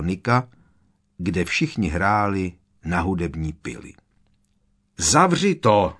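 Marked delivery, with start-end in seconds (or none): 5.0-6.0 loud, higher yell; shouting over an awful screech; roaring. A narrator's reading. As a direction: bellow it to shut the noise out. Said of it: "zařval Fred".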